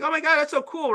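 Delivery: high, gushing schoolkid imitation; high-pitched mock enthusiasm; excited student. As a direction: act out an over-eager pupil. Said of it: "and then I was like".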